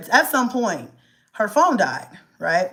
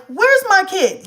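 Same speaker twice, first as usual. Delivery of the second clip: demanding mom; 11 semitones higher, 5 dB louder, slower than usual. Retold or imitated. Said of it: "I call him and I'm like".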